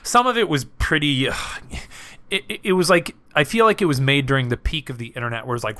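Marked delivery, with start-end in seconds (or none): none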